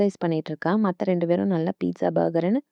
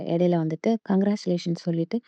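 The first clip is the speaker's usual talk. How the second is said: ordinary delivery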